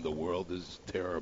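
low, soft, droning mock of a coworker's voice; dreary mock humdrum mumble. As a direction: drone it out like the gloomiest man alive. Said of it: "his regular humdrum".

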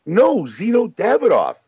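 high, slow, exasperated correction; imitating Emily. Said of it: "She's like".

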